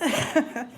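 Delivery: short shaky laugh from nerves; nervous laugh. Real, not acted. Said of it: "Sorry, I'm a little nervous".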